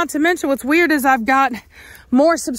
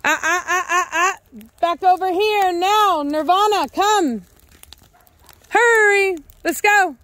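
calling the dog; 7 semitones higher than usual. Real, not acted.

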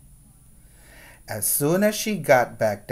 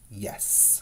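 whispered yes; 4 semitones lower, 14 dB quieter than usual. Acted. Mortimer answers defiantly.

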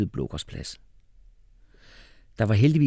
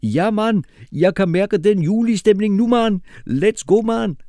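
very high, loud hyped-up voice; pumped-up enthusiast; 14 semitones higher, 9 dB louder than usual. Acted. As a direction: cheer it out, pitched way up.

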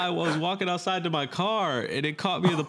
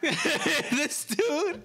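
high laughing squeal; 14 semitones higher than usual. Real, not acted. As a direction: crack up high. Laughing at the outro.

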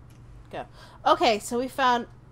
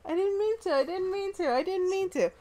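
high pleading whine; 11 semitones higher than usual. Real, not acted. Apologising to annoyed friend.

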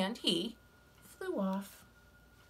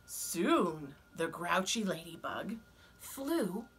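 ordinary delivery, nothing marked

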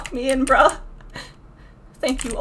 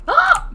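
short high yelp; quick high "ah" of alarm; startled yelp; 5 dB louder than usual. Real, not acted. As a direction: cry out high and sudden. Hit during the game.

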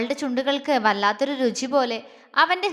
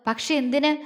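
ordinary delivery

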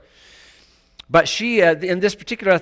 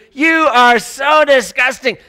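slow, loud, higher sneering accusation; Satan's condemning voice; 9 semitones higher, 11 dB louder, slower than usual. Acted. Satan condemning the listener, slow and loud. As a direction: spit it out as the accuser. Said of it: "that is Satan".

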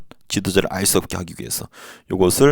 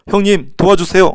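begging king; 9 semitones higher, 5 dB louder than usual. Retold or imitated. King begging Qing for help.

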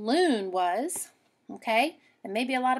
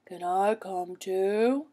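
slow, lightly voiced moose; a big slow moose asking; 2 semitones lower, much slower than usual. Moose asking the girl, slow. Moose asks slowly, other timbre.

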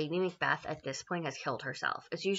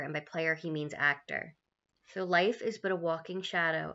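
normal talking voice

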